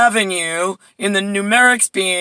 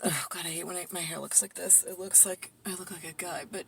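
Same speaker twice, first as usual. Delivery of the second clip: muttered aside; 20 dB quieter, faster than usual. Real, not acted. Vlogger grumbling to herself about her hair, muttered softly. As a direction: grumble it under your breath, half to yourself.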